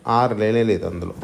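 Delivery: normal talking voice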